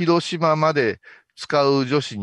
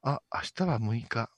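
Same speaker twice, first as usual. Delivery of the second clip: languid office lady; 2 semitones lower, 6 dB quieter than usual. Shown as a demonstration. Director sketches an OL monologue for the actress, languid. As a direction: think aloud wearily, with long pauses. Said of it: "ちょっと気だるさだけでいいね".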